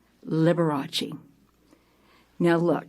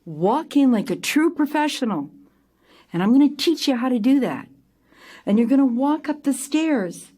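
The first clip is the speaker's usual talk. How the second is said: higher, emphatic acted lecture; stern pep talk; 7 semitones higher, 3 dB louder than usual. Director to crying performer on the sidewalk, firm lecture. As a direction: push each phrase up, scolding and coaching.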